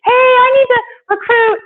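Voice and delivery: high, loud whiny demanding voice; whiny caller. Retold or imitated. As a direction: mimic a needy caller begging. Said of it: "getting phone calls".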